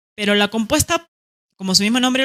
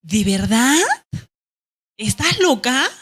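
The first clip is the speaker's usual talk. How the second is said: surprised question; 4 semitones higher, slower than usual. Shown as a demonstration. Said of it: "Cuando pregunto, mi actitud es".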